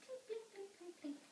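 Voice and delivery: high, soft plink-plink; tiny plucked-string noises in a high voice; imitated ukulele plinking. Storyteller imitates the boy's ukulele, high plinking. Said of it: "he'd play the guitar and blink".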